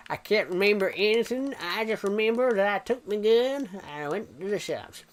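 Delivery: put-on playful American accent for the stream. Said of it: "I could do the American accent".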